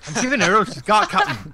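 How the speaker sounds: high, loud mock Scottish engineer; straining Scottish starship engineer; Scotty. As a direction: cry out in a hammed-up Scottish accent. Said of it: "Scott from Star Trek".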